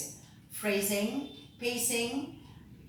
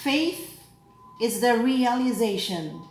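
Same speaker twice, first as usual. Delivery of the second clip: measured, solemn proclamation with deliberate pauses; lector reading scripture; 3 semitones higher than usual. Acted. Lector proclaiming the readings to the assembly, solemn.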